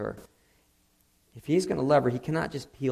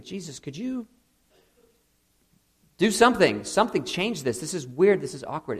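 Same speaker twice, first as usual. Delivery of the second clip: higher, pleading and exasperated, louder in the middle; exasperated plea; 6 semitones higher, 4 dB louder than usual. Pretend. Uncomfortable dinner guest silently begging Jesus, exasperated plea. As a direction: beg Jesus to fix the awkward moment. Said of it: "you're kind of thinking".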